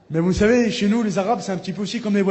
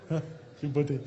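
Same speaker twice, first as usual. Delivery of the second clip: laughing; 2 semitones lower, 7 dB quieter, much slower than usual. Real, not acted. Rire après l'esquive.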